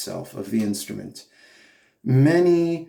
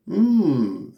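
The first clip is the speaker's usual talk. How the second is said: hummed "mm", pitched higher than speech; a short hum that lifts then falls; gentle hum; 4 semitones higher than usual. Shown as a demonstration. Coach shows singers the look of amusement, humming. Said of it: "bring a little bit of a hum in there".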